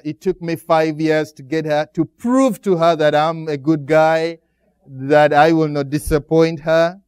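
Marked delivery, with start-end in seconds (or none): none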